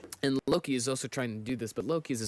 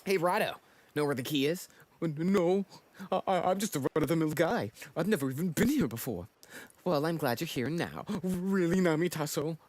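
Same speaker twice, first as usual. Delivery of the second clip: higher, livelier character voices; lightly acted comic characters; voiced manga characters; 5 semitones higher than usual. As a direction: read the panels as the characters.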